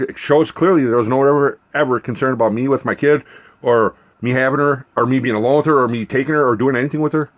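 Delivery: ordinary delivery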